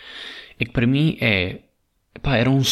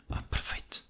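whispering; 16 dB quieter than usual. Real, not acted. Delighted at having no contact.

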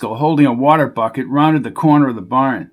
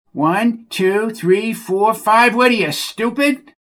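higher, whiny voice of a dim stable hand; exasperated Bucky; 9 semitones higher, slower than usual. Acted. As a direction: scold the horse like a fed-up oaf. Bucky counting for horse.

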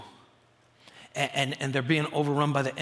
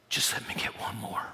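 softly imitated plea, lower and half-whispered; hushed prayer; 6 semitones lower, 6 dB quieter than usual. Preacher retells the rescuer asking God for one more, hushed plea. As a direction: act out a quiet, low prayer under the breath.

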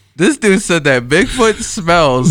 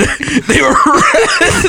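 much higher, slowed and laughing; laughing repeat; 12 semitones higher, much slower than usual. Real, not acted. Friend confirming the red underwear, laughing it out. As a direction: laugh through the answer, drawing it out.

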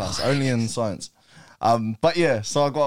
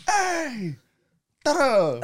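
high-pitched, showy hello; excited greeting; 13 semitones higher, much slower than usual. Retold or imitated. Student walking in to greet his teacher, excited. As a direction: re-enact a thrilled arrival with a ta-da. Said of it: "so excited to see him".

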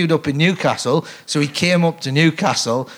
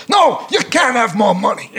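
Mel Brooks snapping back; 8 semitones higher than usual. Retold or imitated. Director answering an actor's question, high comic shout. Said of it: "And he goes".

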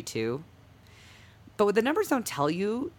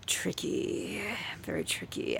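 whispering; 7 dB quieter, slower than usual. Acted. Mock-secretive pause before answering.

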